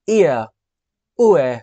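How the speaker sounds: ఇయా and ఊఏ glide from one vowel to the next; gliding diphthongs. Shown as a demonstration. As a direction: sound ఇయా, ఊఏ as two vowels sliding together. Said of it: "ఇలా అన్నమాట". (ఇయా, ఊఏ రెండు అచ్చులు కలిసి జారుతూ)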